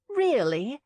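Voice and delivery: bright eager high question; light up as you ask it; beaming. A narrator's reading. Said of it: "Abby beamed".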